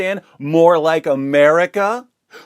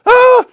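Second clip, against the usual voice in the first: short high loud mock shriek; a burst of fake panic, much higher and louder; mock panicked scream; 11 dB louder than usual. Commentator mimics claustrophobic agoraphobe journalists, high mock shriek.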